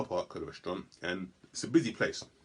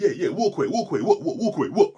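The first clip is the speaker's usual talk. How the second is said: louder and higher, chest-puffed taunting; aggressive taunting; 8 semitones higher, 10 dB louder than usual. Retold or imitated. Big man scaring people off, loud taunting. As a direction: push them off with bravado. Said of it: "I'm like".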